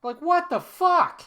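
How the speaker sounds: high, slow, drawn-out what the fuck; stunned disbelief. Real, not acted. Vlogger reacting to his old classmate being family, stunned. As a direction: let the shock stretch out high and slow. Shocked at coincidence.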